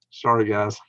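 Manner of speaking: ordinary delivery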